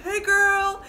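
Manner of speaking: put-on high, sing-song drunk hey girl; drunk sister greeting. Drunk sister greeting her sibling, high sing-song. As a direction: mimic a wasted sister cooing. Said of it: "She's like".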